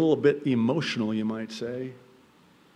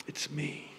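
hushed reveal; whisper; 2 semitones higher, 11 dB quieter than usual. Acted. Joseph revealing himself to his brothers in a whisper. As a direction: breathe it out softly.

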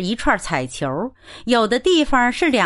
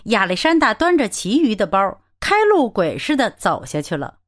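plain reading voice

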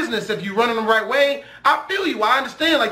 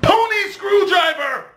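higher, shouted fake curse word; mock curse shout; 9 semitones higher than usual. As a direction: yell a made-up curse like it's the worst. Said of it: "most creative curse words".

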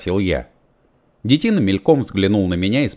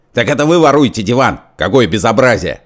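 loud, higher scandalized cry; indignant outburst; 4 semitones higher, 6 dB louder than usual. A narrator's reading. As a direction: raise a fuss, outraged. Fat man indignant. (возмущённо, громко)

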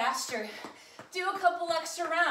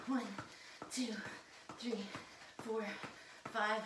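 normal talking voice

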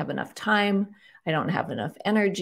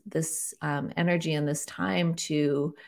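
ordinary talk, nothing marked

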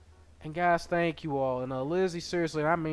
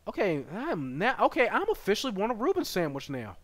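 ordinary delivery

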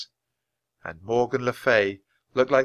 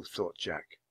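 ordinary delivery

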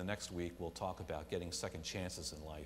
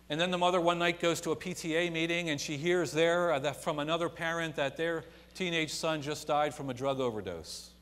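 full-force preaching; 7 semitones higher, 7 dB louder than usual. Acted.

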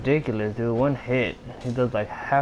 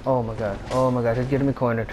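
ordinary talk

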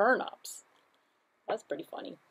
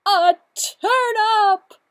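high, loud overjoyed squeal; thrilled shriek; 13 semitones higher, 16 dB louder, much slower than usual. Acted. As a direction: squeal with delight. Cow's thrilled line.

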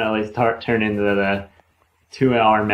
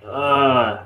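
a short fed-up "ugh"; mild mock groan of annoyance; annoyed groan; 3 semitones higher than usual. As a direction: groan at the thought of a chat. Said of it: "I'm like".

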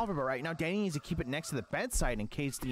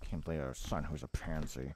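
very low and much softer, under the breath; low muttered aside; 14 semitones lower, 5 dB quieter than usual. Acted. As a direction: grumble it low so the kids can't hear.

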